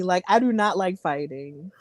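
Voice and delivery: ordinary talk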